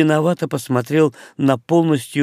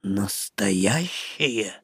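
low, very slow, soft query; a deep doubtful question; baffled orc; 5 semitones lower, 8 dB quieter, much slower than usual. Acted. Orc asks.

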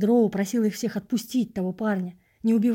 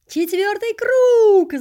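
drawn-out shout, much higher and louder; crowd roar; 12 semitones higher, 10 dB louder, much slower than usual. Acted. Spectators at the race roar out the lap, slow and loud. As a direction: bellow it like a stadium crowd. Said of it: "заорали зрители".

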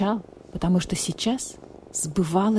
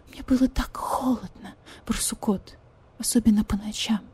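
tearful whisper; 4 semitones higher, 4 dB quieter than usual. Acted. Cold nights, whisper with crying.

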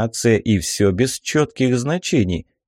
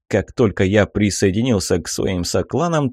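ordinary delivery

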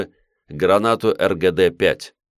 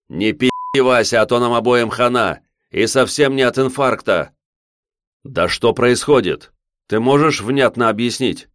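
louder, higher shouting over rotor noise; yelling over helicopter; 5 semitones higher, 4 dB louder than usual. Acted. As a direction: shout to be heard over the engine.

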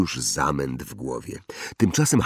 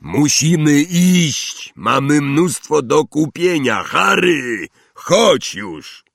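loud, raised, drawn-out call; big booming summons; shout over noise; 9 semitones higher, 6 dB louder, slower than usual. A narrator's reading. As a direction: holler across a chattering crowd. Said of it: "udało się przekrzyczeć podniecony gwar".

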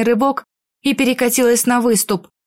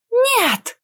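high sharp cry of refusal; shout; 12 semitones higher than usual. Acted. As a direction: scream out a sudden no. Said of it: "закричала". (крик)

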